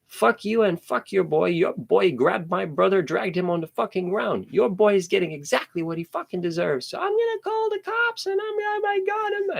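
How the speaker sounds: hammed-up raging kid yelling at a mother; retold cursing. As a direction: relive telling her off, voice cranked up. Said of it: "I'm cursing at this fucking lady".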